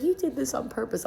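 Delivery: high-pitched mock-angry accusation; mad accusation. Older sister blaming her sibling for going into labor, mad. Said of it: "she was mad at me".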